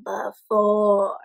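ordinary delivery, nothing marked

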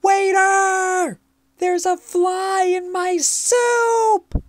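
high, piercing alarmed scream; very high-pitched, outraged yell. A narrator's reading. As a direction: shriek loudly for the waiter in shock. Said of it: "The lady screamed".

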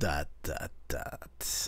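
dot dot dot trailing into a whisper; fading to a whisper. Pretend. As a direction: let each dot fade away. Echoing the shrinking text.

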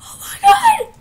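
very high, loud shriek; shrieking panic. Real, not acted. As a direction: scream it in real alarm. Eevee battle going badly.

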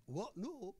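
ordinary delivery

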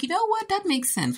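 high put-on voice for Marion; Marion's voice. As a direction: pitch it way up, mock-agreeing. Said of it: "And Marion is like".

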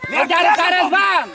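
high-pitched sideline yell; real coach's shout to a player; shouted warning. Real, not acted. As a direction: call a sharp warning across the pitch. Coach warns player during play.

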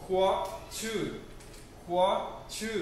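"हुआ छ" said slowly, syllable by syllable; teacher modelling "हुआ छ" for students; Chinese model pronunciation. Shown as a demonstration. Teacher shows students how to say "हुआ छ", carefully. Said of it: "कैसे बोलेंगे इसे?".

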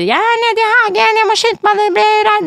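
mother mimics grandma hurrying in from the rain; imitating grandmother. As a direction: play a fussing grandma calling up from the garden. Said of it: "Mormor sier".

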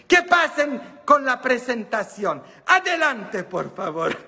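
high, loud mock host announcement; talk-show host welcome. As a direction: call them up like a TV host.

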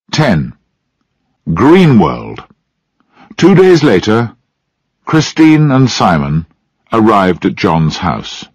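plain reading voice

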